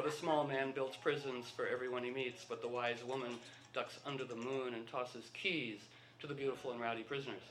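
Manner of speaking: soft, hushed poem reading; recited verse. Acted. Reciting a Hafez poem to the room, softly. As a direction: lay the lines out like a poet.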